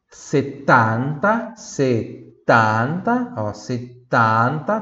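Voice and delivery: setanta very slow with wide open a. Shown as a demonstration. Teacher stretches the open a in setanta. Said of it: "tem que abrir bem pra sair o A".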